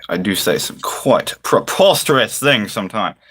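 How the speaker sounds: ordinary talk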